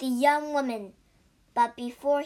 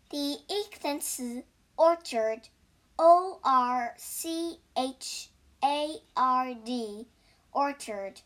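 plain reading voice

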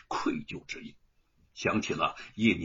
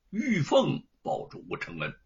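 ordinary delivery

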